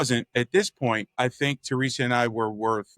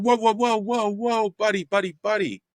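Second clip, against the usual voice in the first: high, stunned retold objection; incredulous protest; 9 semitones higher than usual. Realtor stopping a client who berates him, incredulous protest. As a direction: cut him off, pitched well up.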